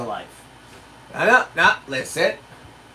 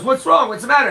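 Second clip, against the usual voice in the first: lightly voiced worried young cashier, pitched higher; a quick higher-pitched show of concern; 7 semitones higher, 4 dB louder, faster than usual. Young cashier asking a customer, lightly imitated worry.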